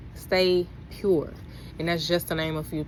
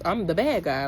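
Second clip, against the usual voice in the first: ordinary talk, nothing marked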